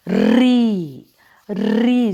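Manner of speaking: री called out loud with a rolled r; loud ri demo. Shown as a demonstration. Teacher models री for confused children, loud and clear. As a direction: belt out री for the class to copy. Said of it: "इसको हम कैसे बोलते हैं?".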